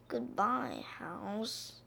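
very soft, tiny farewell; whispered small voice. A narrator's reading. Baby Duck leaving home, whispering in a small voice. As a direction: barely let it out, small and reluctant. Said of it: "whispered in a small voice".

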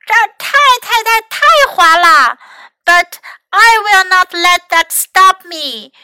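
high, loud, insistent shout; yelling. A narrator's reading. Mac on the slippery hill won't be stopped, yelling. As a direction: shout it out with defiant force. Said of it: "麦克大喊道".

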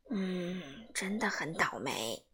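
hushed, low, gentle agreement; soft sympathy. A narrator's reading. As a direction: murmur it like a kind grandma. Grandma sympathising softly. (奶奶轻声同情地说)

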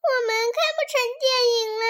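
high, loud lament; wailing dismay. Acted. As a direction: whine that all is ruined. Dismay at the ruined film.

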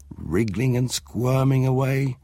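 ordinary delivery

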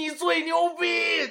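high, slow hype shout. Retold or imitated. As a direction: hype the class up. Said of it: "教练就像那个Y Y喊麦一样".

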